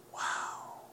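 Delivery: breathy, unvoiced "wow" of amazement; awed wow. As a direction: let it out as a stunned, whispered breath. Retells medic's stunned reaction.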